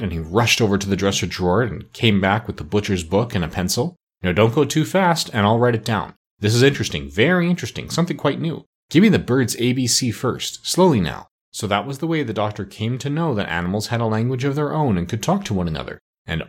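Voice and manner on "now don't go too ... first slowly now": ordinary delivery, nothing marked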